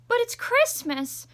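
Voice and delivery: put-on high, loud whiny child; little brother protesting. Little brother calling after Tilly, high and whiny. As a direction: plead like a disappointed little kid. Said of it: "her brother called".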